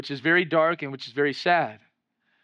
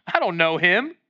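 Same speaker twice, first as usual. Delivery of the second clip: offhand brush-off in usual pitch; dismissive denial; 4 dB louder than usual. Acted. Peter denying Jesus to the young girl, casual dismissal. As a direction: shrug off the accusation.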